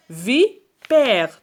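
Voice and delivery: vi... père, split and stretched; syllable-by-syllable. Shown as a demonstration. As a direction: sound out vipère in two beats. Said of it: "regardez bien".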